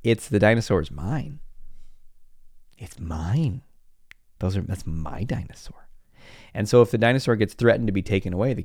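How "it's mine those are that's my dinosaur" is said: put-on soft, secretive kid's whisper; possessive whisper. Child clutching his dinosaur, possessive whisper. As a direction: hug the toy and hiss it's mine.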